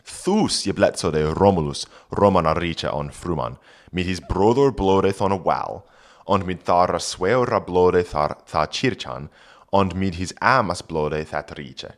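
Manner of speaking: put-on reading Old English aloud; careful Old English pronunciation. Shown as a demonstration. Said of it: "reads Old English text".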